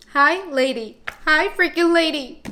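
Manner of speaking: excited greeting. Real, not acted. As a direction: cheer the lady's name.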